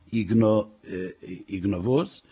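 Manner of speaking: faltering attempt at "igno-"; low, halting, baffled repeat; puzzled stumbling. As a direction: trip over "igno-" and give up. Wife stumbles on unknown word.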